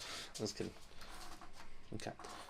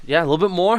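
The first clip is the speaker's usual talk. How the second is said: ordinary delivery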